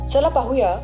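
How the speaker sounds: normal talking voice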